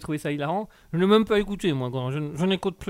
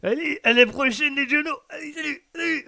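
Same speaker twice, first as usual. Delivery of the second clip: high squeaky farewell; uncle's goodbye; 10 semitones higher, 4 dB louder than usual. Acted. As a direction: chirp goodbye like the uncle, pitched up. (tonton qui dit au revoir, voix très aiguë)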